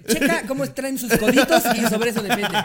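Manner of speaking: laughing through quoted instructions; loud laughing mock order. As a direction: say it while cracking up. Tour manager instructions, laughing.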